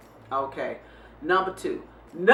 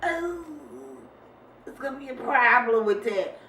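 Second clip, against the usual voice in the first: imitating son; 6 semitones higher than usual. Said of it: "He goes".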